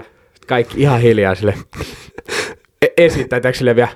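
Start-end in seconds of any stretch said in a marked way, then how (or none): none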